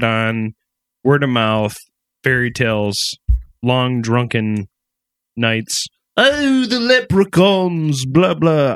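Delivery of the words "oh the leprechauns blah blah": badly faked Irish accent, high; hammed-up attempt at Irish. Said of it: "I can't do an Irish accent".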